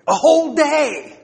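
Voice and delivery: loud, high, drawn-out WHOLE DAY; emphatic insistence. Real, not acted. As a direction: drive it home, stretched and loud. Emphatic repeat driving the point home.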